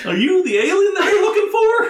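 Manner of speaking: high, loud, cracking with laughter; laughing shout. Retold or imitated. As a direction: yell it through helpless laughter. Mocking the dumb captain, laughing.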